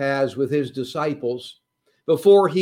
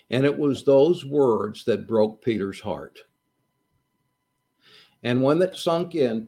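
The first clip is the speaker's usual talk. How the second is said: ordinary talk, nothing marked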